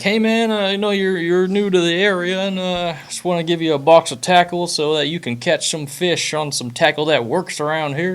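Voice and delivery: high, friendly Southern guy voice; Scott impression. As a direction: do a high, easygoing Southern buddy. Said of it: "That's my Scott impression".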